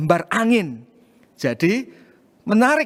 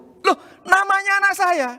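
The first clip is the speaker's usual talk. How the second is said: a sudden high jump of happy surprise; surprised; 13 semitones higher than usual. Retold or imitated. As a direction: gasp it out, much higher, astonished. Finding daughter's name as winner.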